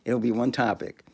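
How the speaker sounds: normal talking voice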